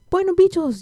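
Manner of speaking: put-on high, loud Salvadoran accent; lively imitated Salvadoran accent. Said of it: "with that Salvi accent".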